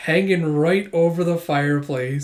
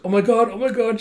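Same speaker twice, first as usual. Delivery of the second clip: high, flustered fangirl babble; acted nervous excitement; fidgety fluster; 7 semitones higher, faster than usual. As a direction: panic giddily under your breath. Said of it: "She's just fidgeting".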